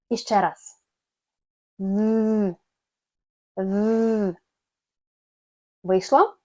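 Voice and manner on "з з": long voiced th, tongue between the teeth; sustained buzzing «З» with tongue between teeth; bee-like buzz. Shown as a demonstration. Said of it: "як бджілка".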